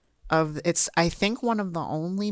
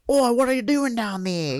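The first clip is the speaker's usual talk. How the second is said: higher, louder mock Australian voice; Australian accent; 6 semitones higher, 5 dB louder than usual. Podcaster imitating the film's Australian characters, in an Australian accent. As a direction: put on a broad Australian accent. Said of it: "sorry for Australian listeners".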